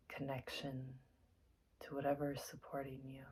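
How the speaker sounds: soft, unhurried meditation guide with long pauses; guided meditation. Acted. Teacher settling listeners into their seats for meditation, soft and calm. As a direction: gently talk them into stillness, leaving space between phrases.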